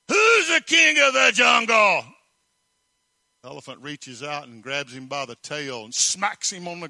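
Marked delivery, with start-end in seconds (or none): 0.1-2.0 high, loud bullying lion roar; roaring lion challenge. Acted. Lion struts up to the monkey, roaring challenge. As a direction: bellow it like a swaggering lion. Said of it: "and he meets the elephant".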